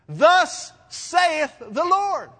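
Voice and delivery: slow, loud, ringing proclamation; proclaiming. Real, not acted. Preacher proclaiming to his congregation, loud and slow. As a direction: declare it slowly with full authority.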